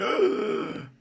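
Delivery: mock gasp of surprise; startled waking gasp. Waking up surprised by everything, startled gasp. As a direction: gasp as if jolted awake. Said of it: "I wake up and I'm like".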